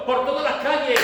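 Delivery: high, loud, fervent sermon voice; preaching at full force. Acted. Preacher urging the congregation, loud and fervent. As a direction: rouse the church, proclaim it.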